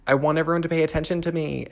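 high, loud, rushed mock demand; attention-seeking. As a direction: play up a needy plea for attention.

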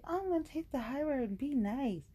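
high, wavering fake-nice voice; sing-song show of being nice; mock high-minded. Acts out resolving to be nice.

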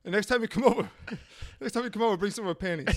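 lightly hammed-up movie drug dealer; a hint of sleazy dealer banter. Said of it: "That's how drug dealers talk".

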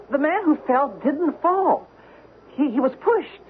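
urgent unease, every word weighed; shaken. Acted. Wife insists he witnessed murder.

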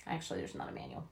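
very soft, low, quick admission; hushed aside. Real, not acted. Admitting to viewers there's no manual, hushed aside. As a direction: drop it right down, almost whispered.

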